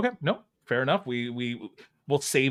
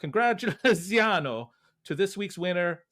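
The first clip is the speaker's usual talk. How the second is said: put-on Mario Italian accent; 6 semitones higher than usual. Host reading co-host's outro to listeners in a hammy Mario Italian accent. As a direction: ham up a video-game Italian plumber accent. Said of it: "give me, like, your best Mario".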